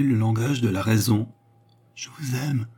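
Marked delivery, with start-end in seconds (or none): 1.9-2.7 soft, hesitant declaration of love; stammered confession. A narrator's reading. Fernand confesses love to the young woman, stammering softly. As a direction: let it out quietly, falling away. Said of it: "balbutia Fernand".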